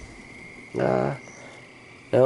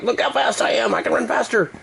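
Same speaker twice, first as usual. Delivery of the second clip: much higher boastful little bug voice; 12 semitones higher than usual. Pretend. Voicing the bug racing round the lampshade, high and boastful. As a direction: squeak it out like a show-off bug. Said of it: "He's like".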